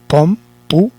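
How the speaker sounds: "computador" broken into com, pu, ta, dor, with pauses; syllable by syllable. Shown as a demonstration. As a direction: spell out computador one syllable at a time. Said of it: "la última sílaba es dor, porque yo digo". (computador sílaba por sílaba, con pausas)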